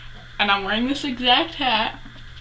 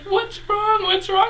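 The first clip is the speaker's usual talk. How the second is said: high-pitched worried imitation; imitated friend fretting in a very high voice; mock concerned friend; 12 semitones higher than usual. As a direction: squeak out panicked mock concern. Friend reacts to her crying.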